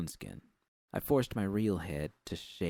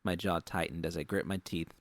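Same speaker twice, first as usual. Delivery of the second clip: plain reading voice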